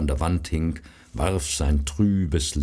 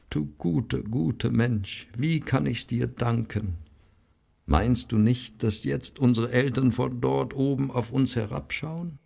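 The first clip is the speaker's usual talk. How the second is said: grateful sister; 7 semitones higher than usual. Acted. Grateful sister thanks brother.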